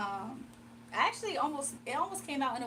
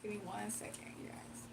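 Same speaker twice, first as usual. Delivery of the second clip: hushed aside; 2 semitones lower, 14 dB quieter than usual. Real, not acted. Pausing to pull cards quietly.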